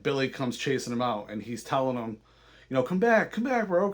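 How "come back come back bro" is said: higher-pitched mock call, not really shouted; retold plea, pitched up as if calling down the road; calling after someone. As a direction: imitate someone chasing and calling out. Chasing, calling after departing rider.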